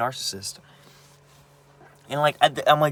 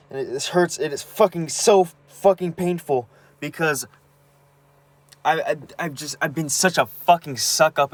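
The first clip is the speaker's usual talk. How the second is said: real pain breaking through, rising and louder; upset, close to tears; 5 semitones higher, 5 dB louder than usual. Real, not acted. Venting to camera about her mom, upset and near tears. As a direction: voice pitched up, strained with hurt. Breaking down over mother's abuse.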